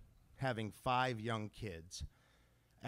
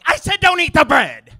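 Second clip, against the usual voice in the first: much higher, much louder full shout; 19 dB louder than usual. Acted. Dad blowing up at crying kid about bread, full shout. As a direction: scream it across the restaurant. Dad's final blow-up.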